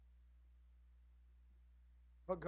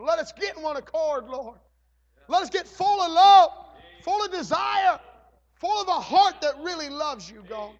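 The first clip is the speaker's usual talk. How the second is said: much louder and higher, shouting each phrase; fervent shout; 9 semitones higher, 17 dB louder than usual. Acted. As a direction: let it burst out with passion. Prayer climax shouting.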